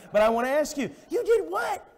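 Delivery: high, loud challenging preaching; incredulous preaching. Acted. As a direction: confront the sinner with disbelief. Preaching at full force.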